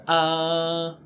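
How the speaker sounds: a long open back ah. Shown as a demonstration. Teacher demonstrates the dentist vowel ah. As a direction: open wide and hold ah. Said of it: "open back sound".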